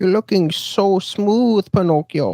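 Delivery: put-on very slow, high Italian-accented crooning; Italian Geppetto voice. Mocking Tom Hanks as Geppetto, slow high Italian croon. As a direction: croon it like a creepy Italian old man. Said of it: "he made one with an Italian accent".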